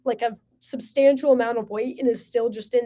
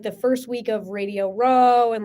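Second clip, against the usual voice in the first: ordinary talk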